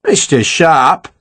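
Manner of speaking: slow, drawn-out shocked address; taken aback, stretching the words. A narrator's reading. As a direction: draw it out in mild shock. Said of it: "Dodd said, shocked".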